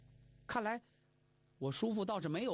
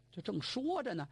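ordinary delivery, nothing marked

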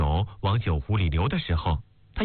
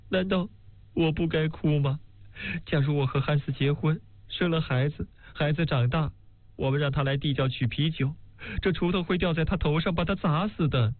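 higher voice, mild mock tearful lament; Elsie lamenting; 6 semitones higher than usual. A narrator's reading. Elsie answers the maid, a lightly voiced tearful lament. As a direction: plead that crying is only sensible, pitched higher.

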